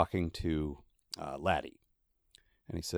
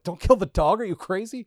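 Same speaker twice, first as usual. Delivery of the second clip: alarmed readers; 10 semitones higher, 7 dB louder than usual. Retold or imitated. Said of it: "his beta readers were like".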